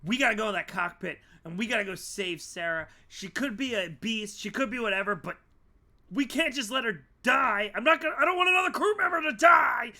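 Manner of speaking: builds from loud to a fast shout; Nancy urging the crew to save Sarah, building to a shout; urgent rising plea. Acted. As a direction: drive them to save her, ending in a yell. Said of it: "Nancy goes".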